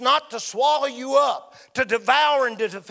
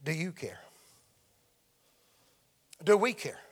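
hushed question; 7 semitones lower, 8 dB quieter than usual. Acted. Preacher drops to question.

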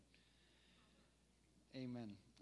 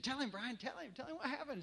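urging pastor; 9 semitones higher than usual. Retold or imitated. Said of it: "he said".

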